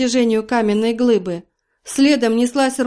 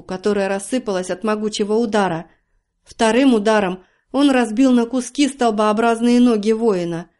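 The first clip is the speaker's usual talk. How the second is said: ordinary delivery, nothing marked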